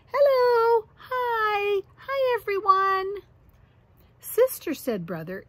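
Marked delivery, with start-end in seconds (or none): 0.1-3.2 put-on high, bright little girl voice calling hello; chirpy little sister. Little sister greeting everyone in a high chirpy voice. 4.2-4.7 high, firm call of her name; stern big brother. Acted. Brother calling out to warn his friendly sister.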